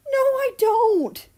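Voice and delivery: high, loud, slowed protest; shrill denial. Acted. Russia yells back at Mal, high and loud. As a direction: shout the denial, flustered.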